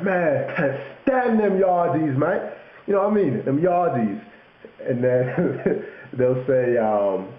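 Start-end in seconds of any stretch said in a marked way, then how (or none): 0.0-4.2 high-pitched mock Londoner complaining; London slang. A Londoner complaining to a mate, mock London accent. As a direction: imitate a grumbling London lad, pitched up. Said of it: "So they'll be like".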